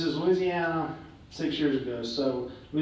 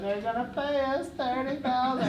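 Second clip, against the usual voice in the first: laughing brag; 10 semitones higher than usual. Retold or imitated. Bragging to father, laughing.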